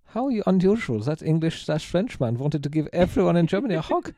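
high, hammed-up German accent; terrible German accent. Host voicing Germans reacting to a hug, bad German accent. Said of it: "for my terrible accent".

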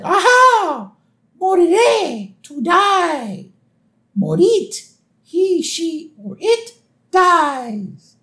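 very high, declaiming voice; hammed-up high cartoon triumph; grandiose wizard. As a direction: recite verbs like magic spells. Dream wizard declaiming French verbs.

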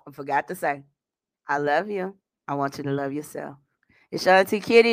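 usual speaking voice

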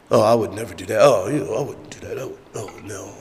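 low, soft, fading mock refusal; mock disdain. Proud churchgoer turning down humble work, mock disdain. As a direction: demur under the breath, trailing almost to nothing. Mocking people above menial tasks.

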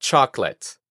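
"Chocolate" joined as CHOK-let; two-syllable "Chocolate", no middle o; corrected English pronunciation. Shown as a demonstration. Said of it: "la segunda O la eliminamos". ("Chocolate" en inglés, sin la segunda o)

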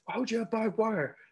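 high, indignant complaining voice; mock outrage at the barbed wire; whiny Michael Douglas. Douglas's character complaining about the fence, high and indignant. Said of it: "So he's like".

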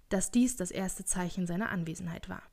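ordinary delivery

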